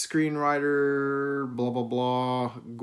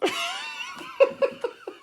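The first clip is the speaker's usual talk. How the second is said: high cackling laugh; 3 dB louder than usual. Real, not acted. Cracking up at the quote.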